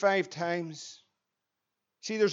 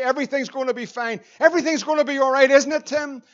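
mock assurance; 4 semitones higher, 6 dB louder, faster than usual. Real, not acted. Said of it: "preacher mocks false assurance, building".

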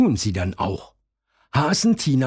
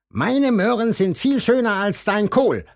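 high bragging bunny voice; high-pitched boastful rabbit; Hasen Tim boasting; 11 semitones higher than usual. A narrator's reading. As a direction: brag over the fence in a high voice. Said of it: "sagt Hasen Tim".

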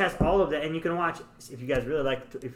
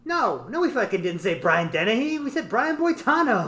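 higher-pitched indignant correction; mock exasperated; 7 semitones higher than usual. Film's kids correcting a misheard name, mock exasperation. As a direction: act out an exasperated retort, slowing at the end.

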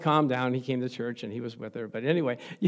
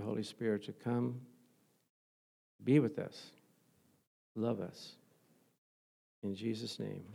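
prayer; 3 semitones lower than usual. Acted. Leads a prayer.

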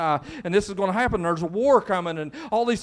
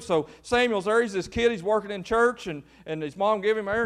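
ordinary delivery

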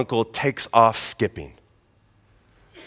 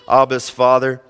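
normal talking voice

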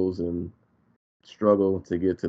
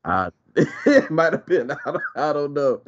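laughing; 6 semitones higher than usual. Real, not acted. Laughs while answering.

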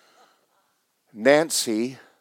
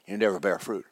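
usual speaking voice

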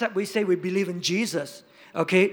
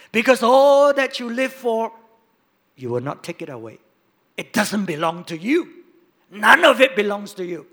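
loud bursts, then dropping; loud preaching emphasis; 9 dB louder than usual. Real, not acted. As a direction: pound the point home. Preacher driving point home.

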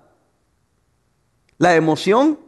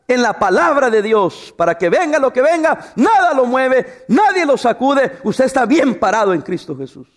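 ordinary talk

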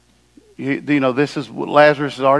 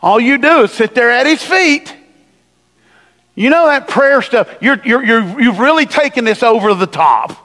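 irritated Martha; 7 semitones higher, 4 dB louder than usual. Retold or imitated. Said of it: "this is what distracted people do".

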